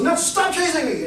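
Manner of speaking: high-pitched acted protest; yelled plea. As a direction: plead high to be left alone. Abner yells at his pursuer again.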